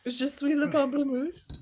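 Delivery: high, hammed-up French; hammy French accent. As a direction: play the silly tourist in French. Joking bad French.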